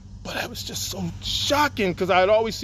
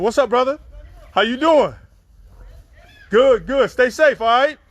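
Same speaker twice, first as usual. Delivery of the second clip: louder, higher, upbeat shout-out; greeting the chat; 5 semitones higher, 5 dB louder than usual. Real, not acted. Host greets a viewer in the chat, upbeat and louder. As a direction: call out a cheerful hello to a viewer.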